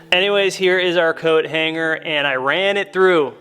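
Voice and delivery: ordinary talk, nothing marked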